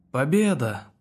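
triumphant shout. A narrator's reading. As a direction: call out the victory. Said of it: "крикнул Евгеник".

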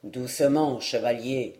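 a small man checking a rival, low and steady; the dwarf warning. Acted. Dwarf to the eager knight, low calm warning.

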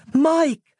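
high, urgent yell of a name. A narrator's reading. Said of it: "Roz yelled".

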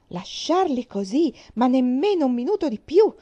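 loud, slowed opening, indignant; angry refusal. A narrator's reading. Aunt refusing the girl's plea, angry and loud. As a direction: slam the door on the idea.

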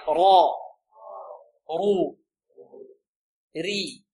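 reciting the three vowels of ra for the class; ra ru ri drill. Shown as a demonstration. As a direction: lead the class through را رو ري. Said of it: "انطقوا معي".

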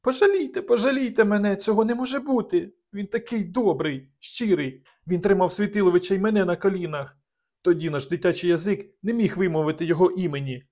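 a frightened lady begging for mercy; pleading young woman. A narrator's reading.

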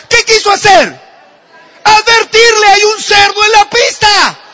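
high, loud sustained preaching; preaching at full force. Acted. Preacher telling the pig story to the congregation, at full force. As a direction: drive the story's climax home.

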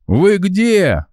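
high yell down the phone; shouting. A narrator's reading. Colleague shouting over the phone, high yell. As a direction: bellow so everyone hears. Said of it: "проорал коллега".

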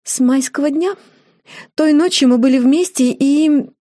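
put-on high-pitched, bashful young woman; bashful confession. A narrator's reading. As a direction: confess shyly in a lifted voice. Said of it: "Джорджина залилась румянцем".

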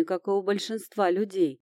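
usual speaking voice

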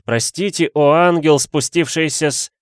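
high, loud adoring plea; gushing apology. A narrator's reading. Suitor begging his angel's forgiveness, effusive. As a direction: pile on worship until interrupted.

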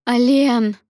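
high, loud cry of a name; shout. A narrator's reading. Lia grabs for Alen in a contraction, shouting. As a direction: cry out for him urgently. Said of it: "снова крикнула Лия".

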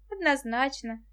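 plain reading voice

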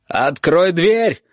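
медленно, громче, с угрозой; рявкни, грозя выломать дверь; ревёт. Acted. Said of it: "ревел теперь уже Валентин". (отчим ревёт за дверью)

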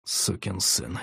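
low, soft seething curse; muttered curse through teeth. Acted. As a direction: grind it out under breath. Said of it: "я скрипнул зубами".

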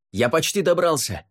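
high-pitched call over a distance; shout. A narrator's reading. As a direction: call out to reassure her. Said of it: "крикнул он".

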